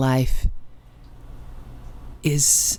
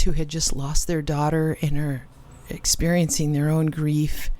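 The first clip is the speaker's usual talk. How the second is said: ordinary delivery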